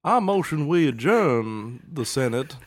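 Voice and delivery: loud, high Southern senator; American politician voice. Shown as a demonstration. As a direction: hold court like a folksy Southern senator. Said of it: "This is my American politician voice".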